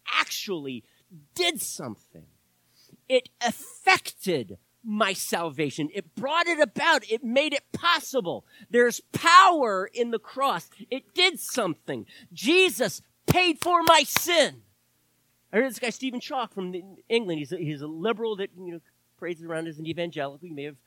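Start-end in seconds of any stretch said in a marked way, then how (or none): 5.9-14.6 raised, high, forceful proclamation; impassioned preaching. Real, not acted. Preacher proclaiming the power of the cross, impassioned.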